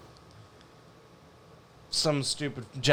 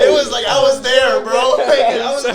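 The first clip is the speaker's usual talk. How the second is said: higher and louder, laughing as he talks; excited, laughing; 9 semitones higher, 10 dB louder than usual. Real, not acted. Laughing to friends about seeing Nazgul at six, excited. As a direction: gush about being right there. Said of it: "laughing recall of childhood fear".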